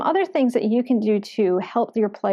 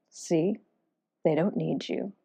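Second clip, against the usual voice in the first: soft, lower little voice; little inner voice; 4 semitones lower, 7 dB quieter, slower than usual. Acted. Depressed inner voice to the weary adventurer, little voice. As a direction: murmur it like a nagging inner doubt. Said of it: "you hear a little voice going".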